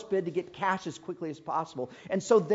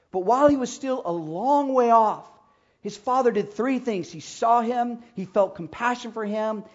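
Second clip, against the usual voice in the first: loud, high, building sermon climax; preaching at full force; 5 semitones higher, 8 dB louder than usual. Acted. Preacher telling the congregation of the father's embrace, fervent preaching. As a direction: swell it up to the father running.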